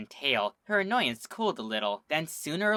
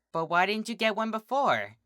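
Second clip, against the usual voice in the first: bubbly high pony voice; curious, high and bouncy; high Pinkie voice; 8 semitones higher than usual. A narrator's reading.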